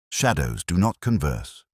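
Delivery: usual speaking voice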